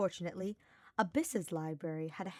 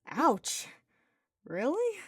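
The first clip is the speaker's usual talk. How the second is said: high, delighted gasp; stifle a laugh; amused surprise; 8 semitones higher, 6 dB louder, much slower than usual. Acted.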